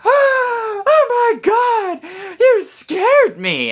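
startled mom. Retold or imitated. Mom startled by her kid at the door, high squeal. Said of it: "and she goes".

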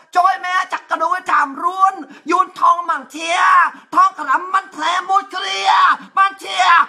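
high, loud mock-Khmer yelling; mock Cambodian shouting. Cambodian villager yelling at the Vietnamese, mock-Khmer shout. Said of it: "nó la làng cẳng cẳng lên".

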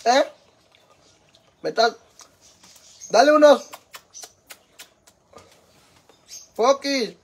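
loud, high-pitched holler; calling out. Real, not acted. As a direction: call for dad across the house. Calling out to father nearby.

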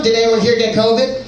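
ordinary talk, nothing marked

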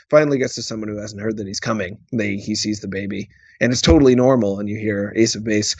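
ordinary delivery

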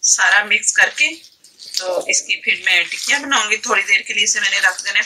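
ordinary talk

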